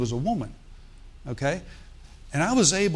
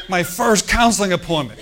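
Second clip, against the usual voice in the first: louder, higher comic disbelief; incredulous; 5 semitones higher, 8 dB louder than usual. Retold or imitated. Pastor tells congregation of his shock at the session, incredulous. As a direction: play up your disbelief for laughs.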